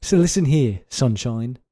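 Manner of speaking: hammed-up British scolding; theatrical telling-off in a fake British accent; bad British accent. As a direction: put on an unconvincing British accent and scold them. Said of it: "how bad [name]'s accent was".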